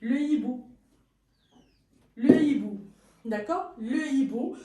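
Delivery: le hibou with no link, le kept whole; no liaison, aspirated H. Shown as a demonstration. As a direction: keep le apart from hibou. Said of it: "H aspiré".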